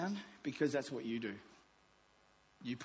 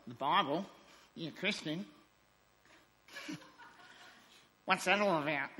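mock puzzled coworker; 2 semitones higher than usual. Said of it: "They don't all talk like that".

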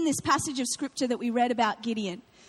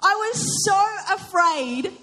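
drawn out slowly, each word weighted; slow emphasis; 4 semitones higher, much slower than usual. Acted. Preacher confessing to the congregation, slow and weighty. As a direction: let "I was so afraid" hang slowly. Preacher repeats key phrase.

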